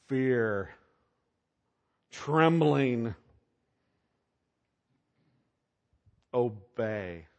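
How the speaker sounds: each word alone, falling, with long pauses; words set apart. Shown as a demonstration. Preacher listing the negative words, each weighed slowly. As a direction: drop each heavy word slowly, one by one. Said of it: "these are the words I see".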